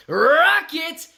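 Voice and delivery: loud, high, drawn-out name call; hyped shout-out. Real, not acted. Streamer hailing a chatter, hyped shout-out. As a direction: holler the name, high and long.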